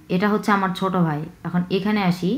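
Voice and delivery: normal talking voice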